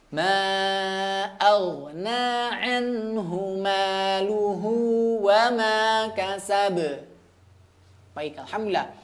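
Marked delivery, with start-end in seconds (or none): 0.1-6.8 very slow chanted recitation of the verse; Quran recitation. Acted. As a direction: recite the verse slowly, melodically. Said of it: "Kita baca dulu". (tilawah ayat pelan dan berirama)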